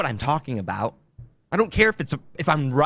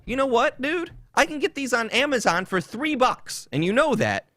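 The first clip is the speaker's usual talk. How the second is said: 1 mock confrontation; 10 semitones higher than usual. Retelling confronting the salesman.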